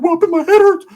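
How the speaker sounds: squeaky high whine; a goofy high whimpering Ricky; dazed Ricky. Retold or imitated. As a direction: whine it in a tiny high voice like your head's caved in. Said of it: "Ricky's like".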